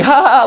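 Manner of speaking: a sister humouring him with a laugh; mock forced laugh. Teller imitates little sister's forced laugh, mock fake ha ha. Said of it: "I feel her force a laugh".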